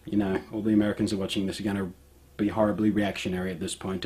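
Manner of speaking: ordinary talk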